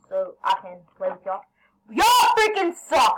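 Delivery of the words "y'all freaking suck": slow, high, loud scream. Real, not acted. Gamer screaming at the green team, slow and high. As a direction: drag it out in real anger. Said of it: "I'm sorry for screaming".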